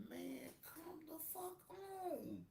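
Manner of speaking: breathy disbelieving whisper. Real, not acted. Streamer whispering disbelief at the co-host. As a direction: whisper it in disgust. Reacting to co-host's flip.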